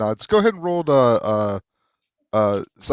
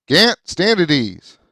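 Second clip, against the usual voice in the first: loud, higher, slow military order; commanding officer; 6 semitones higher, 7 dB louder, slower than usual. Acted. Captain orders his corporal to stand at ease, commanding. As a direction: call out a slow, firm order to a soldier.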